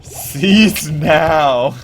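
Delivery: booming official order; loud stern command. Pretend. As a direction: bellow it like a corporate ultimatum. Repeats the order louder.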